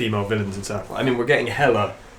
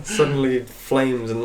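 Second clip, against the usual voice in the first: normal talking voice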